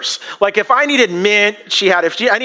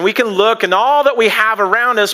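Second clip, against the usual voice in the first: ordinary delivery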